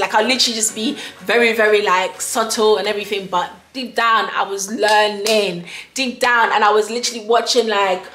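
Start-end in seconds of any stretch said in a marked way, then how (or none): none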